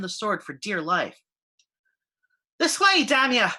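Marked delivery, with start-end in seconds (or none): 2.6-3.5 gruff dwarf shout from afar. A narrator's reading. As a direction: bark it across the trees. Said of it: "Moira's shout echoed off the trees".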